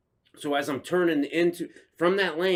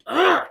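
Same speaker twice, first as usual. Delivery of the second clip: loud, high exclaimed grunt; imitated grunt; 13 semitones higher, 6 dB louder than usual. Storyteller mimicking the biker's look, loud grunt.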